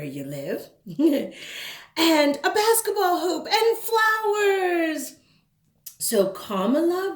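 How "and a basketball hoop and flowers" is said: put-on high, eager little girl asking; bright child voice. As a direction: play the curious kid, pitched up. Kid voices ideas.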